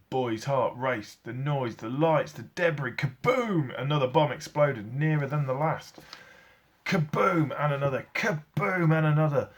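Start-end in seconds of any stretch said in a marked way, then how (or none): none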